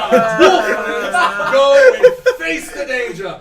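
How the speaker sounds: loud, slow, high grand declaration; slow booming boast; pompous proclamation. Acted. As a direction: proclaim it like a hero. Fink volunteering grandly.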